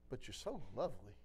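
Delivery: hushed, tender reassurance; tender voice of God. Retold or imitated. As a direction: speak it like a loving whisper of comfort. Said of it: "and he said".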